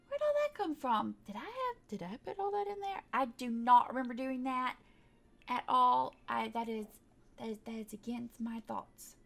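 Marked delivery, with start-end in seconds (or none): none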